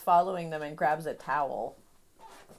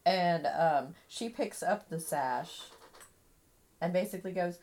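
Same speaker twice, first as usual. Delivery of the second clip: ordinary delivery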